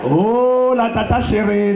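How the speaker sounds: normal talking voice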